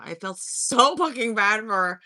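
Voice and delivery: ordinary talk, nothing marked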